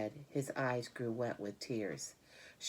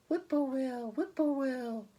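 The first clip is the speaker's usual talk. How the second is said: high, far-off whippoorwill call; birdcall; 13 semitones higher, 5 dB louder than usual. A narrator's reading. A bird across the road calling at dusk, high and sung. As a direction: sing it like a distant bird. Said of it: "a bird sang".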